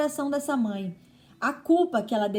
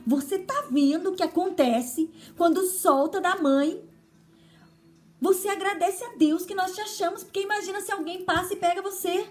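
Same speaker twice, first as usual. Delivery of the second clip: higher-pitched mock scolding of a lost son; retold scolding; 7 semitones higher than usual. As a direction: replay the angry mother, pitched up. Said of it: "eu fiquei muito brava e falei pra ele".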